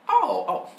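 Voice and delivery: high excited salesman "Oh, oh". Retold or imitated. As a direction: squeak it out like an eager seller. Salesman voiced in a story.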